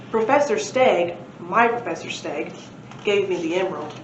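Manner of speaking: plain reading voice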